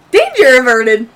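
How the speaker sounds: loud, high, halting announcement; Kodo. Acted. Game master's character reporting after closing the vent, loud announcing. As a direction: announce it in slow, separated bursts.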